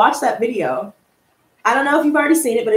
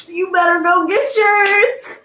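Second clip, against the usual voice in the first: excited; 8 semitones higher, 3 dB louder than usual. Real, not acted. Hyping her hoodies.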